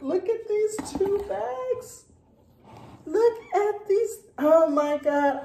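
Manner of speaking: high-pitched gushing, bursting with delight; real giddy excitement, voice shooting up; excited squeal. Real, not acted. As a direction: squeal it, thrilled and unrestrained. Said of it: "I can be as excited as I want".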